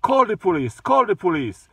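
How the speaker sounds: imitating an angry passenger, pitched high; real mad. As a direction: throw it out as a mock angry dare. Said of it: "Real mad".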